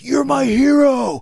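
high, slow, drawn-out holler; imitated adoring cheer. Ex-inmates to the showman on the table, mock-adoring shout. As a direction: stretch it out like a fan cheering.

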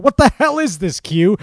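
loud, high exasperated outburst; captain's indignant shout; captain calls out. Acted. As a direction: bark it at the prankster like a fed-up captain. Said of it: "as the captain called out".

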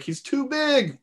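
high-pitched retold cry of alarm; mock alarm. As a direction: strain it high, imitating a man losing a struggle. Retold dude struggling with huge gator.

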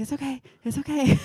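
lightly voiced friend calming her; soothing friend. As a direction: imitate him calming her fast. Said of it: "he's like".

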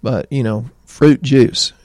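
usual speaking voice

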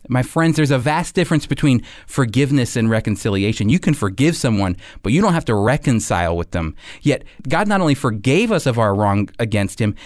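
ordinary talk

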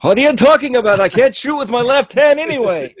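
high, loud whiny complaint; whiny protest. Acted. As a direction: protest like the wounded cowboy. Voicing the cowboy's protest.

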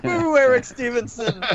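high, playful jeer through laughter; mock booing. Mock booing of Eric Stevenson.

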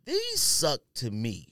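normal talking voice

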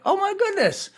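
high, wide-eyed oh my goodness; a jump of delighted surprise; delighted surprise at a screen; surprised gasp. Retold or imitated. Watching the series, surprised to see him.

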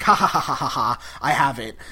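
a hammed-up villain cackle, then the words; mock triumphant laugh. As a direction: gloat with a laugh over the prize. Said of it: "he starts laughing".

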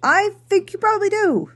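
high teasing aside. Real, not acted.

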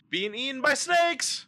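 high-pitched, mock-dire reveal; dramatic announcement. Host tells Marcus how to read it, mock-dramatic. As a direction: announce it high, like a horror prompt.

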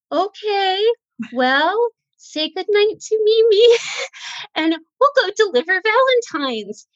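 forced cheery voice. Retold or imitated. Mother to the children, hiding the bad news with a high forced cheer. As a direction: put on a brave sing-song cheer.

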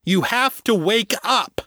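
high, insistent call; urgent high-pitched plea; loud voice. A narrator's reading. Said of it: "the loud voice said".

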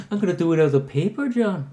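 high, quick mock Irish accent; Irish-leaning accent. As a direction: slip into a bad Irish-sounding impression. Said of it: "Wait, that's more Irish".

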